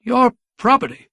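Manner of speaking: high, shocked disbelief; choked disbelief. A narrator's reading. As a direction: choke out the words. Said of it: "The mayor choked".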